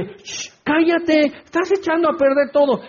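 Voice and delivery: shush, then high-pitched mock rebuke; scolding to silence. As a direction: act an annoyed crowd hushing the beggar. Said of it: "muchos le reprendían para que callase".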